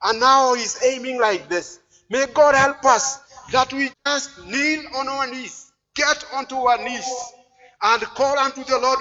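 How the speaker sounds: forceful preaching. Real, not acted. Preacher urging soldiers of the Lord to kneel, forceful exhortation.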